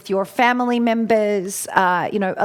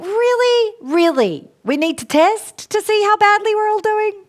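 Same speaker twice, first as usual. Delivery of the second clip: high mock disbelief, pitched way up; mock-outraged, squeaky incredulity; incredulous quote; 11 semitones higher than usual. Mum scoffing at home testing, high incredulous squeak. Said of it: "I'm like".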